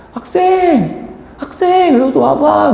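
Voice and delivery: put-on high-pitched, drawn-out old-lady call; grandmother calling out. Grandmother beckoning a student from over there, high calling voice. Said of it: "할머니가 날 부르는 거야".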